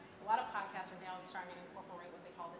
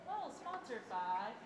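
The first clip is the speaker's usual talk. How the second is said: mock bored ad read; 4 semitones higher, 7 dB louder, much slower than usual. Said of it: "instead of just".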